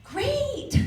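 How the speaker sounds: very high, gushing mom praise; praising mother. Acted. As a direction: cheer a small child on in a high voice. Mother praising child over again.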